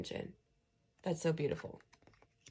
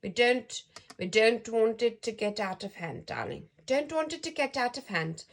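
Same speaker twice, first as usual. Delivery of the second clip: put-on raised South African accent, emphatic; higher-pitched South African granny voice; South African grandma; 3 semitones higher, 5 dB louder than usual. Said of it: "Sounds like my grandma".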